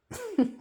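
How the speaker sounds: short hiccuping laugh. Real, not acted. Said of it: "you will have to forgive my hiccups".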